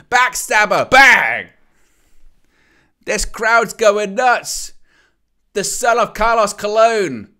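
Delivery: loud, high, thrilled commentary; excited shouting. Real, not acted. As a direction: yell it like the crowd just erupted. Crowd hero returns, hype commentary.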